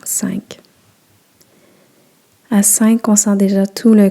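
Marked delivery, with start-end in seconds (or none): 0.0-0.6 whispered count. Acted. Guide counting down into relaxation, whispering "Cinq.". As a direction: whisper "Cinq." softly, almost breath only.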